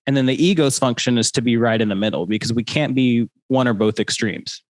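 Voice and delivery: ordinary talk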